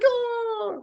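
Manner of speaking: hammed-up fan shock; mock surprise. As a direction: gasp like a stunned fan. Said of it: "we're like".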